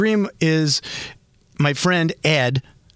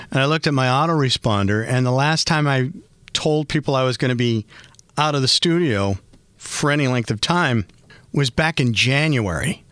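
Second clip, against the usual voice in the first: ordinary talk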